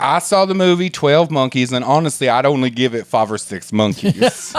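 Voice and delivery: comic film reviewer character; put-on character voice. Character rating a movie in monkeys, put-on voice. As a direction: start high, settle into a deadpan verdict. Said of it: "I'm transfixed by this character".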